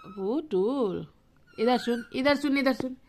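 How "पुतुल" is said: Putul drawn out very slowly, lower and soft; slow modelled word. Shown as a demonstration. Coaxing the parrot to repeat its name, very slow. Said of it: "Putul बोल बेटा".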